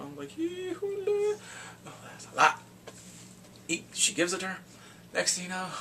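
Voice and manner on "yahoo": whoop. Real, not acted. Cheering on the screen.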